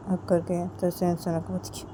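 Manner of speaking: usual speaking voice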